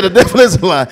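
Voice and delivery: loud repeat leaning on is; scared exclamation. Retold or imitated. Startled usher repeating his cry to the Lord.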